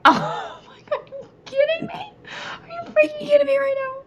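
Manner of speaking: high, crying-laughing disbelief; squealing disbelief. Retold or imitated. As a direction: squeal like you can't believe it. Husband filming her, exasperated.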